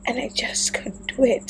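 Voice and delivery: soft whispered admission. Real, not acted. Vlogger whispering that she couldn't write it. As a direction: breathe it out, defeated.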